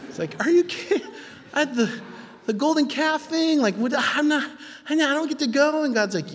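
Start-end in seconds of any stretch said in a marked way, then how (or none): 0.4-1.0 much higher, laughing disbelief; incredulous. Acted. Moses learns he can't enter. 1.5-5.8 much higher, flustered protest; indignant whine. Acted. Moses protests to God.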